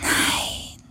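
soft whispered no. Acted. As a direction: whisper the denial. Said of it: "flüsterte der Geist". (geflüstert)

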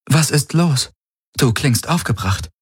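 ask, puzzled and very earnest; lightly voiced serious concern; confused, serious. A narrator's reading. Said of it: "Sie klang verwirrt und sehr ernst".

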